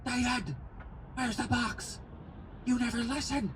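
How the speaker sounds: high-pitched imitation of mom yelling at dad. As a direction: mom screeching at dad about the box, mocked high. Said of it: "My mom goes".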